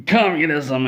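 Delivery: high, loud heroic shout; battle cry. Acted. Bernie leaping at Todd, shouting his battle cry. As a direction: yell it as you leap.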